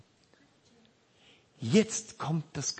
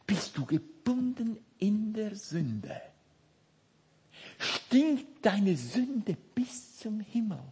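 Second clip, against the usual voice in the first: ordinary talk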